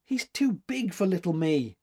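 put-on high, discouraged little-girl plaint; dispirited. A narrator's reading. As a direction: admit defeat, feeling small. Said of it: "said the girl dispiritedly".

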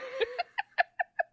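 high-pitched helpless giggle; high laugh. Real, not acted. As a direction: squeal with laughter. Laughing fit.

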